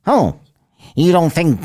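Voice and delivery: high, annoying mimicry; half-managed high nasal impersonation; attempted nasally voice. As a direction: try on someone's irritating nasal voice. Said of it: "oh, I can't do his voice".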